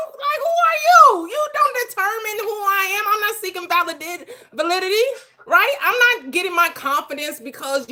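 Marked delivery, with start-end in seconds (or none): 0.1-3.4 loud challenge. Real, not acted. Heated reply to condescending caller.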